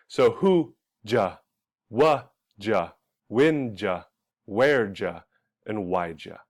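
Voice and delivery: "who ja", "wa ja", "when ja" with did you blended into "ja", slowly; slow drill of each reduced pair; drilled reductions. Shown as a demonstration. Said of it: "sounds like".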